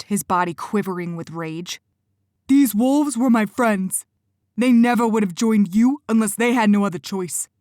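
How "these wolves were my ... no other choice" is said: furious protest. Acted. Benny defends the wolves to Quentin, loud and furious. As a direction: defend your friends with rage.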